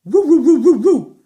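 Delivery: loud, high woo barks; a playful dog barking loudly; dog howling woos. Acted. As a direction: bark like a bouncy dog. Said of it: "said Bottomley Potts".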